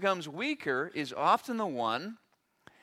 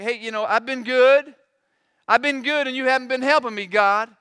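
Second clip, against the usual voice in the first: louder, aggrieved whine at God; huffy complaint; 6 semitones higher, 9 dB louder than usual. Retold or imitated. Job complains to God, louder and huffy. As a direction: play Job sulking and demanding. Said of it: "Job getting all huffy with God".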